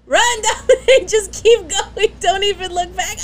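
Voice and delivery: high, loud laughing shout; laughing cheer. Real, not acted. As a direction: cheer him on through laughter.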